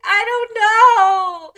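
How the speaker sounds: loud, high, drawn-out mock cry; wailing child. Child quizzed by angry dad, mock tearful wail. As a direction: wail it slowly like an upset kid.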